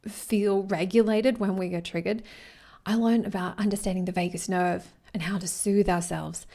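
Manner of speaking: ordinary delivery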